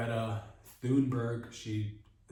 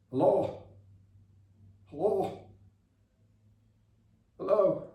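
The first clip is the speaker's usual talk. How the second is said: high, loud hello; mock urgent phone caller; calling down the line; 7 semitones higher, 4 dB louder than usual. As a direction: call out into a dead phone. Caller calls into dead phone line.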